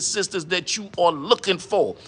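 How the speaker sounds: normal talking voice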